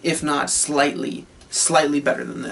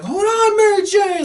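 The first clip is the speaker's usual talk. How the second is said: much higher hero call. Retold or imitated. Spider-Man calling to Mary Jane, high urgent call. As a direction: shout across to her, urgent. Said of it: "he's like".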